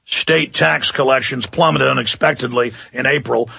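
normal talking voice

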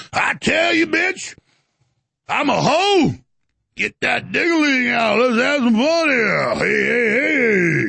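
high, brash, hammed-up street voice; mocking fake Fat Albert impression. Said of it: "her fake, uh, Fat Albert voice".

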